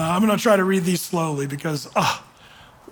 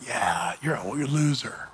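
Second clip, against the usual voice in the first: deep, soft sneering accuser voice; low mocking devil voice; Satan's taunt; 8 semitones lower, 7 dB quieter than usual.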